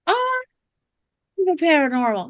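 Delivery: the client lighting up with sudden interest; an imitated client who is thrilled by the paranormal; delighted exclaim.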